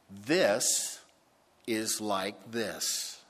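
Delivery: slow, spaced-out "This is like this"; slow illustration. Shown as a demonstration. Preacher showing his congregation what a comparison is, slowly.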